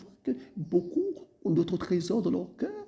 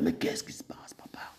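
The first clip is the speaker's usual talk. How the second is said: low hushed prayer, lower pitch; whispered plea; 4 semitones lower, 5 dB quieter than usual. Retold or imitated. Believer asking the father about behaviour, whispered. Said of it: "Tu te dis".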